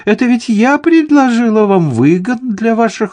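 leaps into a very high, gushing insistence; indignant protest. A narrator's reading. Lady Cameron reminding the count of her favour, indignant protest. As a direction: remind him in an offended high-pitched voice.

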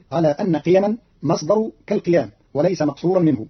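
ordinary talk, nothing marked

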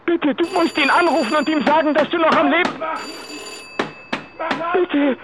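rising, urgent begging on the phone; frantic plea for help; desperate pleading. Acted. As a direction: beg him to call, voice climbing in panic.

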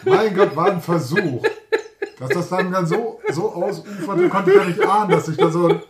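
laughing in embarrassed disbelief. Real, not acted. Laughing about the sketch.

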